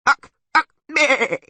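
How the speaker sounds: very high, loud bleating; goat bleat. A narrator's reading. Goat horn answers the taunt, bleating. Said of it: "bleated the goat horn".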